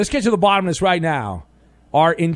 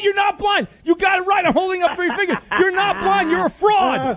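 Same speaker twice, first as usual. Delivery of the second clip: a triumphant yell calling out a fraud; mock outraged shout. Exposing caller as fake blind.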